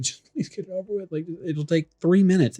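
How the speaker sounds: mock-weary parental coaxing; exasperated pleading. Pleading with child over homework.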